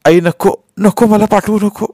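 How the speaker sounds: put-on high, pleading boy's voice; cling to mother and beg; frightened high-pitched begging; terrified child's plea. A narrator's reading. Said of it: "आईला घट्ट धरत मी म्हटलं".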